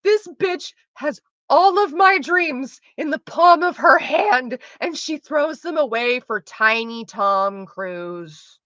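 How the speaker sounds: high, outraged rant, slowing and dropping at the end; yelling at screen. Retold or imitated. Yelling at the computer over her choice, outraged. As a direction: rail at the heroine, drawing out each phrase. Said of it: "I'm yelling at, I'm like".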